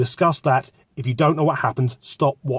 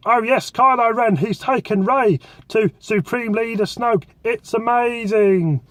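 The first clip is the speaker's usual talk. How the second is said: higher, slow gushing fanboy impression of himself; mock nerdy fanboy; 8 semitones higher than usual. Mocking himself to listeners as a gushing Star Wars nerd, higher and slow. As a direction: ham up the breathless nerd, drawn out. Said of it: "how much of a fucking nerd I sound".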